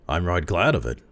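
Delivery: ordinary delivery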